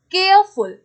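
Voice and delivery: Careful broken into care, pause, फुल; split into syllables. Shown as a demonstration. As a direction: say care and फुल as separate sounds. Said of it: "careful अलग है".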